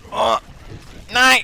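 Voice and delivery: high, loud excited cry; excited shout. Real, not acted. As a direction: yelp it out in excitement. Near win excitement.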